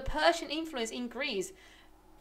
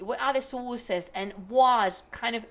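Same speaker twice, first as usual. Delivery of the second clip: usual speaking voice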